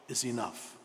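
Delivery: ordinary delivery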